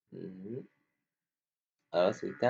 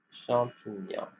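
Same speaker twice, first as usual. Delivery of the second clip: ordinary delivery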